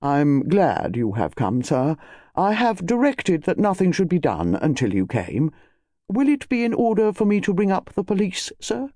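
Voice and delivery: formal, measured butler voice; impressive butler. Acted. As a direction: report with stately deference to the master. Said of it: "The butler stepped forward impressively".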